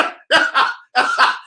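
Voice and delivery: high mocking cackle; imitated laughing. Showing how women laughed at the fiance, mocking high laugh. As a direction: act out their laughter at her. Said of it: "they were laughing at her".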